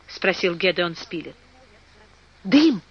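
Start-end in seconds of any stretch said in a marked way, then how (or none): 2.4-2.8 short, higher-pitched exclamation; mildly excited outburst; exclaiming. A narrator's reading. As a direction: call it out as a sudden discovery. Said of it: "воскликнул Герберт".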